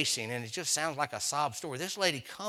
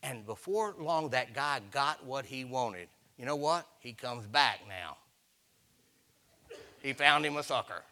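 ordinary delivery

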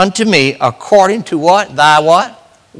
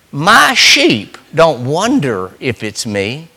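slow emphatic; 3 semitones lower, slower than usual. Acted. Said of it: "preaching emphasis".

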